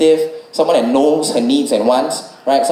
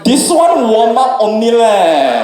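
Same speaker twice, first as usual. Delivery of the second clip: head coach taunting; 3 semitones higher, slower than usual. Retold or imitated. Coach teasing the panting comic. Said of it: "the head coach comes back".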